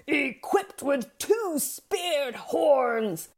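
high, slow goat voice; theatrical goat boast. A narrator's reading. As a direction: announce your weapons with slow grandeur. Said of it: "theatrically announced his intentions".

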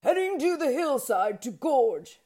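bigger goat explaining. A narrator's reading. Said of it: "He calmly explained that he too was".

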